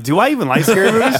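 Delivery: laughing through the question. Retold or imitated. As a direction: giggle the words out, high. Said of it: "I was like".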